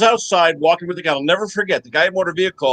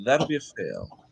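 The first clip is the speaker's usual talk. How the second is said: quiet, much lower, dead calm; deadpan examiner; 5 semitones lower, 9 dB quieter than usual. Retold or imitated. Examiner calmly announcing the failed test, deadpan and soft. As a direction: say it utterly unbothered. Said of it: "I still remember".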